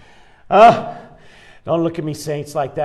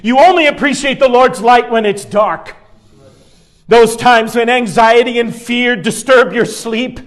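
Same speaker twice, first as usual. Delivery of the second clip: higher, louder, laughing through the sermon; preaching at full force; 5 semitones higher, 5 dB louder than usual. Acted. Pastor rousing the church about hidden fear, loud preaching. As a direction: whip the congregation up with a grin.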